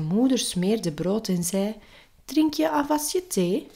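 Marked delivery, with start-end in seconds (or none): 2.2-3.7 high, lilting mother's question. A narrator's reading. Mother to son over breakfast, high motherly voice. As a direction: ask it as a mother, pitched high.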